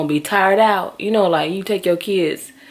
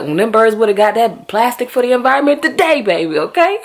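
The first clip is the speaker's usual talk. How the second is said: louder, higher scolding; loud retort; 6 semitones higher, 7 dB louder than usual. Retold or imitated. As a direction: fire back at the kid. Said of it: "I was like".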